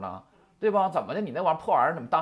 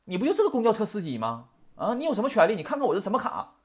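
higher, louder indignant retort; retold haughty passenger; 6 semitones higher, 4 dB louder than usual. Woman scolding the bus driver over her pass, raised haughty retort. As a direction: put the driver in his place, huffy and raised. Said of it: "当时就说了".

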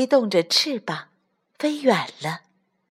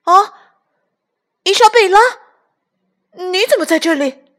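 surprised cry; 12 semitones higher, 8 dB louder than usual. Acted. Surprised to see Isabella.

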